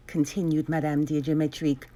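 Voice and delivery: ordinary delivery, nothing marked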